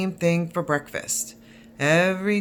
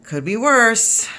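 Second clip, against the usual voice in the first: louder, slow grandpa catchphrase; a bit more emphatic old grandpa; lightly voiced grandfather, louder; grandpa, firmer; 5 semitones higher, 6 dB louder than usual.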